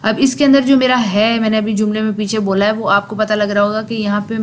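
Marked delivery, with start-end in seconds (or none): none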